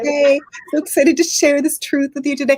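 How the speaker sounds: high, gushing livestreamer parody; mock influencer excitement. Imitating people who go live, mock excitement. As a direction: sell the fake excitement. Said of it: "people go live, they're like".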